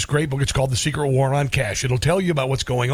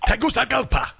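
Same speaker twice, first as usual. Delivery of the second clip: higher, overdone Spanish pronunciation; imitating an over-keen Spanish accent; mock Spanish accent; 6 semitones higher than usual. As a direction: switch suddenly into exaggerated Spanish mode. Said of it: "switch into Spanish mode when they say".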